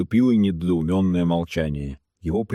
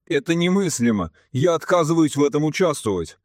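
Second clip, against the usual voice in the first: high-pitched, affronted protest; scandalized objection; indignant refusal; 8 semitones higher than usual. A narrator's reading. Colonel angrily refuses to take part.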